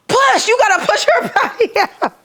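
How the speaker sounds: high, loud urging with a laugh; chorus of coaches, hammed up; everybody at the birth yelling to push.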